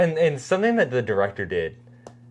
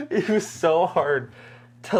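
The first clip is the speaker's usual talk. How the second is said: slow, laughing till almost crying; helpless real laughter; breaking up laughing mid-sentence; laughing to tears; 5 semitones higher, slower than usual. Real, not acted. Laughing at actor's lip.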